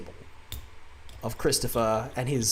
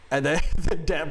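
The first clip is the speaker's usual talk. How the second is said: ordinary talk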